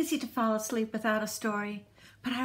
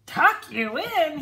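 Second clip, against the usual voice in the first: louder, slower pirate outburst; bellowed; 5 semitones higher, 6 dB louder, slower than usual. A narrator's reading. Braidbeard scoffing at the boy's bedtime wish, bellow. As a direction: bellow it in disbelief. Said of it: "he bellowed".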